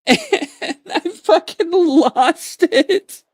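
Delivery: high, slow words broken by real laughter; can't get it out for laughing; laughing hard. Real, not acted. Retelling the perfect timing.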